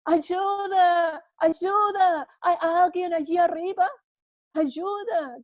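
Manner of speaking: high desperate shout for help; calling up from a ledge, shouting. Acted. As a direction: cry out for help from the cliff. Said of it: "Entonces empieza a gritar".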